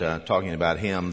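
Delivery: ordinary talk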